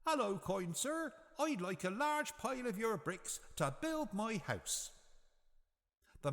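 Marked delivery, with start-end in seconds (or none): 0.1-4.9 very high little pig voice; a polite small pig asking kindly; third little pig. A narrator's reading. Third pig asking the brickyard man, high little voice. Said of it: "He went up to the man in charge of the yard and said".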